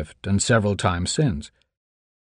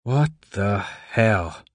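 stunned; much slower than usual. Acted. Stunned after sedation.

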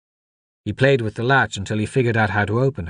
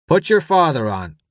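raised voice giving an order; commanding deputy; 6 semitones higher, 4 dB louder than usual. A narrator's reading. Deputy wants the boy's father on the radio, commanding. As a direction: order the child to fetch his father.

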